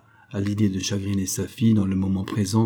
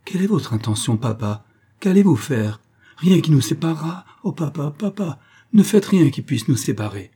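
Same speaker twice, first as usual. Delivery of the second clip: anxious, higher-pitched pleading questions; sudden terror; 6 semitones higher, 5 dB louder than usual. A narrator's reading. Daughter begging father not to separate them, frightened. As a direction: plead with a touch of panic. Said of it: "avec une terreur soudaine".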